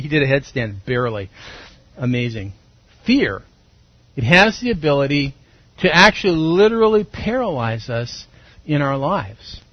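ordinary delivery

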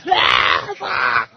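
a high, loud yelp of fright, played for laughs; mock panicked yelp. Pastor voicing his own fright at a neighbor's screaming, mock panicked yelp. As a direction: yelp like you're sure you're about to die. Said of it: "the lady starts screaming and I'm like".

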